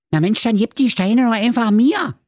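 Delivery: high, loud piglet voice; little pig. A narrator's reading. Marzipeter asks for the heavy stones, piglet voice. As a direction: offer it eagerly in a high pig voice. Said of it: "sprach der Marzipeter Ringelschwanz".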